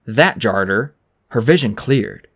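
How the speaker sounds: plain reading voice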